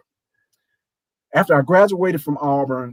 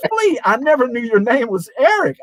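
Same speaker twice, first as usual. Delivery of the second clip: retold surprise; 9 semitones higher than usual. Interviewer's retold surprised recognition.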